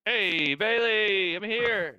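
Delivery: high, drawn-out call. Real, not acted. Player announcing arrival to Bailey, high singsong call. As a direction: call out to a friend, pitched well up.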